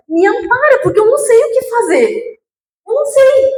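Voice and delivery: rising, high helpless plea; anxious prayer. Retold or imitated. Praying to God, scared of what will happen, high anxious plea. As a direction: beg for help with rising panic.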